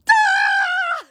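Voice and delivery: very high, loud shriek of exasperation; frustrated mock scream. Mom shrieking at her parents' spoiling, exasperated scream. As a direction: let out a shrill scream of frustration. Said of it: "And I'm like".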